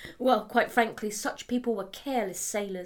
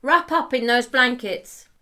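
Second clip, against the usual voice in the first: called out; 3 semitones higher, 9 dB louder than usual. Acted. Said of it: "he called".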